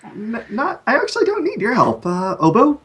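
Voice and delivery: high, light gnome voice. Acted. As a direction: pipe up like a small gnome. Gnome player in character.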